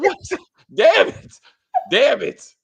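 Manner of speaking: high, loud disbelief breaking into laughter; comic retold frustration; mock exasperated outburst. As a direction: exclaim it in hammed-up dismay. Retelling own exasperated reaction to scene.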